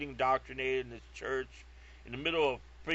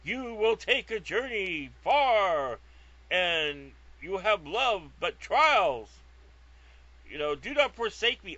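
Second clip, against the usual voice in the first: slow, lofty, louder voice from the heavens, a bit higher; booming voice of God; 5 semitones higher, 6 dB louder, slower than usual. Retold or imitated. Retelling the voice of God he heard under the sun, slow and grand. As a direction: intone it like a divine prophecy. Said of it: "big booming voice going".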